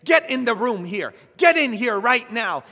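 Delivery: a grandmother yelling at the grandson trying to flee the room; furious shout. Retold or imitated. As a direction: order him back into the room at full volume. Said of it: "looks at me and says".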